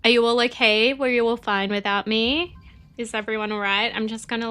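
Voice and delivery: usual speaking voice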